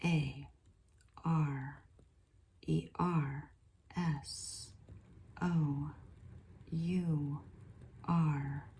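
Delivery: each letter whispered apart with long gaps; slow spelled channeling. Acted. Channel spelling the spirit's message, hushed and slow.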